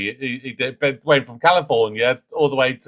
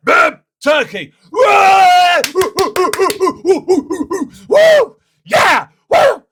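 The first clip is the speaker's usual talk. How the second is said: hammed-up ecstatic cheering, much higher and louder; mock American whooping; 9 dB louder, much slower than usual. Mocking American Family Feud fans with hammed-up whooping. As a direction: whoop like an overexcited game show crowd. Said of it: "The Americans, though, you go".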